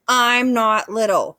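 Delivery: snapped. A narrator's reading. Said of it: "snapped Thorn under his breath".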